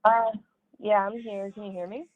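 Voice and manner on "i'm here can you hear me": usual speaking voice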